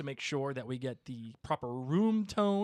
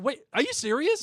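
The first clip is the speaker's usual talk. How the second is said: surprised; 11 semitones higher, 7 dB louder than usual. Real, not acted. Disbelief at friend's news.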